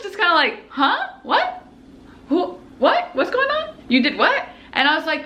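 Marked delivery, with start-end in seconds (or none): 0.7-4.5 high-pitched baffled questions; puzzled murmurs from several people, pitched up; mock bewilderment, rising; imitating confused relatives. Acts out confused family.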